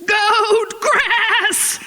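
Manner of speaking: high, wavering goat cry on both words; protesting bleat. Acted. Cub refuses meat from the lion, bleating in protest. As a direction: bleat a stubborn, hammed-up refusal.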